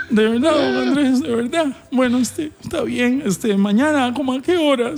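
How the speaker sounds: imitated tearful employee, high; high, pleading put-on voice; Jessy. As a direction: quiver on the edge of mock tears. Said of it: "Ya casi llora".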